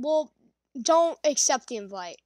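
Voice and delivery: ordinary talk, nothing marked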